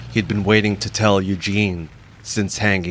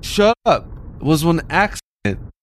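shouting; 7 semitones higher than usual. Acted. Friend teased about trashing tomb.

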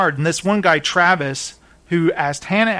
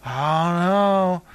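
slow, slurred mock drunk; imitating a woozy drunkard; drunk man; slower than usual. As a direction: answer as if totally wasted. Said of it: "Why did you get so wasted?".

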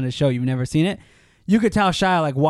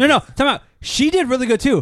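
excited objection; 9 semitones higher than usual. Real, not acted. Interrupting excitedly to defend.

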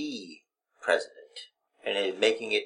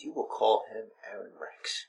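whisper; 3 semitones lower, 12 dB quieter than usual. Acted. Host commanding co-host.

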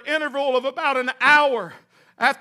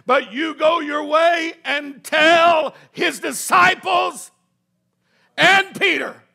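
raised, drawn-out proclamation; emphatic preaching; 3 semitones higher, 6 dB louder than usual. Real, not acted. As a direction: proclaim it loudly, pausing before the key words. Preaches the angel's message.

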